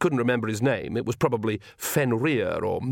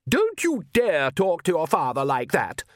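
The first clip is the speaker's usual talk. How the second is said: a contained screech from an outraged mother; screamed; 6 semitones higher than usual. A narrator's reading. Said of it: "screamed the Countess".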